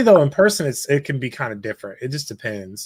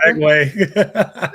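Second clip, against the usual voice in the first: laughing; 5 semitones higher, 5 dB louder, much slower than usual. Real, not acted. Laughing praise.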